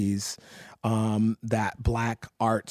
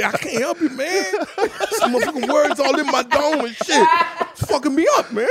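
high, loud, laughing imitation of a crying friend; giggling mock sob; laughing, mock tearful; 7 dB louder than usual. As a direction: quote him through laughter. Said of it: "Reggie said".

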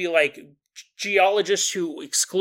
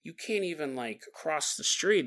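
hushed, baffled aside to a friend; softer, puzzled musing about a changed friend; quiet disbelief; 2 semitones lower, 8 dB quieter than usual. Pretend. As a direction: murmur it, half to yourself. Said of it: "you would think".

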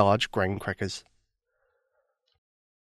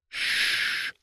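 soft unvoiced hush; whispered shush; 8 dB quieter than usual. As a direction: hush them so the dragon sleeps. Hushing beside sleeping dragon.

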